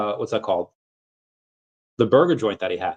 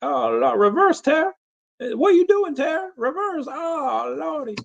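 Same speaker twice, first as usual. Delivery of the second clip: much higher, squealing alarm; horrified squeal. Real, not acted. Streamer reacting to a viewer's surgery photo, squealing in alarm. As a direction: squeal like you can't bear to look.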